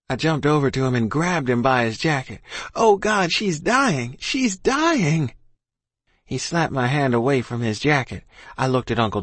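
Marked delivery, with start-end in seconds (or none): 2.7-5.3 high, frantic cry; desperate. A narrator's reading. Boy grabbing the captain as ma dies, desperate. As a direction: cry it out high, climbing to the repeat.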